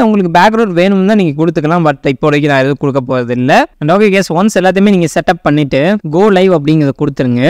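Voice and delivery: normal talking voice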